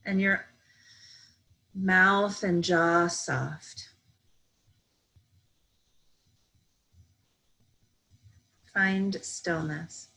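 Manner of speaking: slow, even meditation voice with long pauses; calm, measured yoga nidra guidance; guided meditation. Real, not acted. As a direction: guide them gently into stillness, unhurried.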